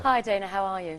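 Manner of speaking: a light South African "Hi, Dana, how are you?"; South African accent. Shown as a demonstration. Actress performing the accent on request for the host. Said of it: "Can you do a little more of it".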